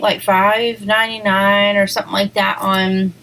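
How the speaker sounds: ordinary talk, nothing marked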